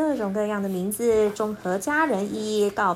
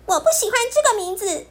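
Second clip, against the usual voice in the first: high, fast, upset shout; loud protest; 11 semitones higher, 5 dB louder, faster than usual. Acted. Bear cub shouts at Pipi to stop the nickname. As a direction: push back hard, hurt and angry. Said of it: "他大声地对皮皮说".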